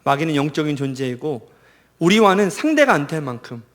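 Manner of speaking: ordinary talk, nothing marked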